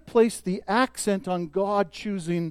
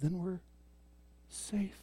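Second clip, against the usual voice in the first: much softer, intimate and close; hushed sermon aside; 2 semitones lower, 9 dB quieter than usual. Real, not acted. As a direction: drop to a near hush to draw them in. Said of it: "intimate soft point about safety".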